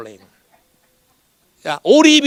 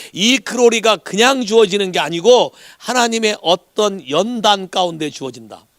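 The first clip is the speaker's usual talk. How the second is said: ordinary talk, nothing marked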